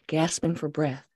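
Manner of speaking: ordinary delivery, nothing marked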